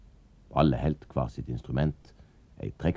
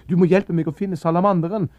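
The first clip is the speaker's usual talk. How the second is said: put-on high, loud child's cry; calling out; 10 dB louder than usual. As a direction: call out to him through the door. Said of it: "ropte Anders". (roper høyt og lyst gjennom døra)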